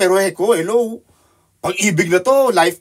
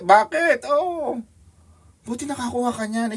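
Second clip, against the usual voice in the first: mock surprise; 9 semitones higher than usual. Acting a friend's surprised question.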